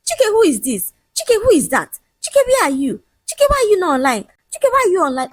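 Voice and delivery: high-pitched mock interrogation; mocking, high nagging voice; mimicked nagging. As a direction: rattle off the questions, imitating a nag. Man mimics fiancée's nagging questions.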